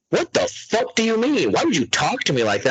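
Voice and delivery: high, indignant, offended snap at his wife; hammed-up outrage; mock indignant outburst. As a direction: act deeply offended at a simple question. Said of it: "And I'm like".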